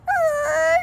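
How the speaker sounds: very high, loud brake squeal; imitated truck brakes. Imitating the truck over there, squealing brakes. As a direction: mimic squealing brakes with your voice. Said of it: "trying to push on its brakes".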